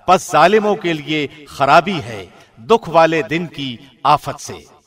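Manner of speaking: ordinary delivery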